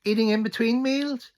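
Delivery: high mocking cashier voice; cashier quote. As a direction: squeak it out like a snooty cashier. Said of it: "turns around and says".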